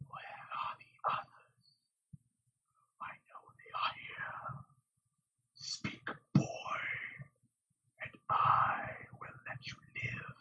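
rasping whisper like a file on bone; hoarse whisper. A narrator's reading. The eyeless Fade cornering Rand, hoarse whisper. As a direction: menace him in a dry, rasping whisper. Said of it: "a hoarse whisper".

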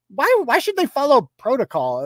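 high mock indignation; sarcastic mock complaint. Sarcastically voices her complaint.

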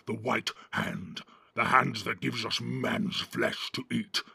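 growling, boastful orc chief; rough, commanding orc harangue; Ugluk. A narrator's reading. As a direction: growl contempt and pride at rival orcs. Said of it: "growled Ugluk".

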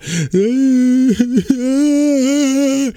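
high, breathy laugh at himself; burst of laughter. Real, not acted. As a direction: laugh out at the memory. Laughs while recalling sobbing.

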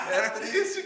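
high laugh-filled complaint. Real, not acted. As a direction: laugh it out, mock sad. Laughing about his nickname.

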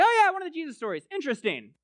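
fast, high, breezy shrug; mock bored reader; dismissive. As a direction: brush the story off lightly. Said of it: "this story is just sort of like".

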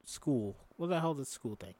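usual speaking voice

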